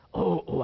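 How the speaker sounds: imitated dog-like cough; two hollow mock coughs; barking cough. Shown as a demonstration. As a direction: mimic an aggressive barking cough. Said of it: "a gente chama de tosse de cachorro".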